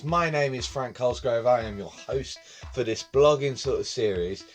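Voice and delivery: ordinary delivery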